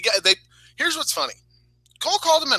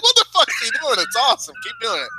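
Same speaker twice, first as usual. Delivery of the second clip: rising high, delighted; excited disbelief; 10 semitones higher than usual. Retold or imitated. Watching a wrestler's taunt, building excited disbelief. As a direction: build from baffled to thrilled. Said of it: "I was like".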